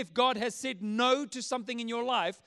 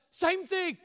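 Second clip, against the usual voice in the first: put-on high-pitched yell of a stubborn kid; mock childish comeback, pitched up; retold boy's shout; 8 semitones higher than usual. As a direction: shout it back like a defiant little boy. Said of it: "the boy shouts out".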